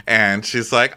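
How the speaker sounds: normal talking voice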